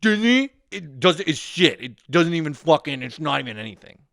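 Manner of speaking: high, loud, sneering parody of the director; mocking a snob. Mocking the director who trashed Disney, in a high sneering whine. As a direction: whine like a pretentious film snob. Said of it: "don't go around being like".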